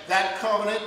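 fervent sermon climax; rising preaching. Acted.

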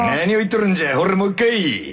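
put-on gruff Hiroshima-dialect old man. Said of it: "なんでこんな広島弁に戻ってんの？". (広島弁のおじいさん口調)

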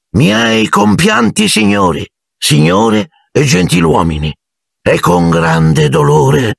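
high declaiming start, slowing right down; pompous funeral speech; solemn orator. A narrator's reading. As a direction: intone a mournful address to the crowd. Ghost begins solemn public speech.

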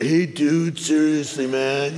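laid-back surfer dude drawl; surfer caricature. Acted. Preacher caricatures Californians for the congregation, surfer dude voice. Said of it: "caricatures of surfers".

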